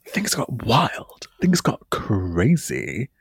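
whispered, slow and secretive; hushed whisper. Real, not acted. Host confessing her wild night to co-host, whispered. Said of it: "hungover story hushed".

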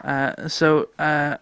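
usual speaking voice

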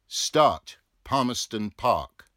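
loud depot call. Acted. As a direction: send the tram off with a yell. Tram official calls out.

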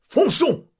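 charge, rouse the troops; rousing charge. Acted. Count urging family off, loud high battle cry.

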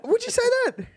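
high teasing squeak; playful high-pitched tease; mock surprise. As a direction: ask it in a high mock-innocent voice.

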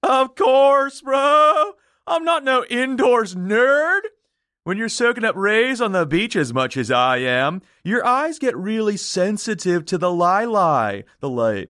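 dumb surfer. Acted. Ventriloquist excuses the dead man's sunglasses, dumb surfer voice. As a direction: start way up like a beach bum. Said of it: "like he's some dumb surfer".

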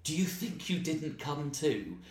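rising, slow indignant comeback; retort. Retold or imitated. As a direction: fire it back at the heckler, building. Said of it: "I actually went".

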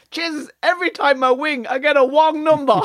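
high caricatured Chinese punchline; high-pitched mock Chinese exasperation; mock Chinese accent. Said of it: "the joke is then".

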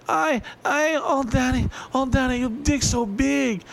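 high-pitched mock moan. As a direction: ham up a breathy bedroom cry.

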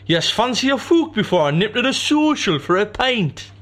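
loud, high put-on northern lad; northern bloke voice. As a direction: ham up a rough northern lad.

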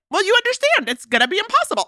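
high, mock-sweet girlfriend impression; mimicking Diana. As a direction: jump way up into a prissy imitation. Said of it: "and she's like".

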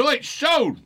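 calling out across the pub; high call out. Retold or imitated. [name] calls Sean Connery, high call. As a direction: shout his name high. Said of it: "I went".

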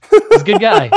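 laughing through the words; cracking up, pitch jumping high. Real, not acted. Laughing after punchline.

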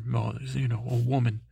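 ordinary delivery

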